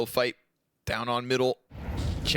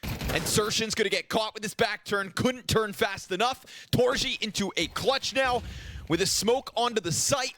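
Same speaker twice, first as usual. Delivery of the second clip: animated, driving match commentary; esports play-by-play; 5 semitones higher than usual. Acted. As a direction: call the round as it unfolds.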